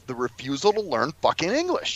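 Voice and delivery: ordinary talk, nothing marked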